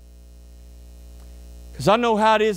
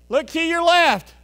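ordinary delivery

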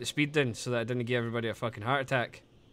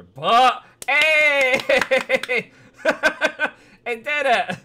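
loud and high, laughing through it; yelling the joke out, cracking up; excited shout; 10 semitones higher, 11 dB louder, slower than usual. Real, not acted. Streamer yelling a crude joke to chat, loud and high.

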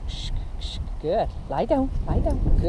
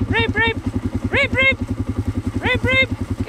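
calling dog; 13 semitones higher, slower than usual. Real, not acted. High calls to dog.

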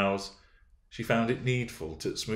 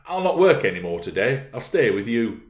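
stubborn, louder; 4 semitones higher, 7 dB louder than usual. Acted. Heathcliff insists.

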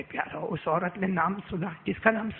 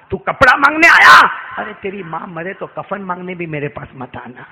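furious shout; 15 dB louder than usual. Acted. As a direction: bark it at the child. Uncle shouting at the boy.

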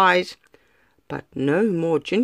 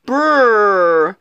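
squealing; 3 semitones higher, 5 dB louder, slower than usual. Acted.

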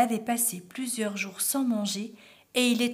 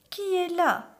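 high put-on granny voice; imitated grandmother; 9 semitones higher, 4 dB louder than usual. A narrator's reading. Wolf in the bed calling out, imitating the grandmother. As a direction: fake an old granny's high call. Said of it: "en imitant la voix de la grand-mère".

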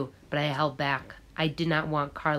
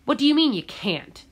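sharp, higher incredulous demand; scornful disbelief; 9 semitones higher, 4 dB louder than usual. Acted. Contractor scoffs at Gabriel's refusal, incredulous. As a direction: boss scoffing at a worker's refusal.

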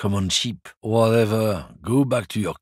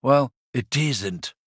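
normal talking voice

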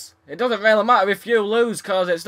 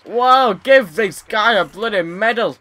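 sarcastic praise; 6 dB louder than usual. Real, not acted. Gamer mocks the opponent who beat him, loud sarcastic cheer. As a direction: heap fake praise on the opponent.

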